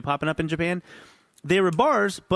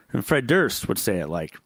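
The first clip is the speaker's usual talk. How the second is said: ordinary delivery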